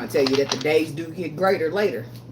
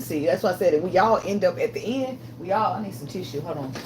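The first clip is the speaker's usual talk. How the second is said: ordinary talk, nothing marked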